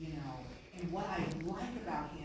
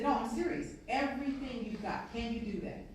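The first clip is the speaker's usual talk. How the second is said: louder, higher, firm insistence; stern coach; 5 semitones higher, 5 dB louder than usual. Retold or imitated. Coach demands everything from quarterback, loud and firm. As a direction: press him hard, coach pushing player. Coach pressing player in story.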